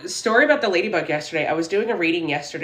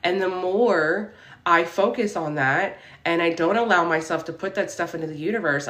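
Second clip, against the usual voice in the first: ordinary talk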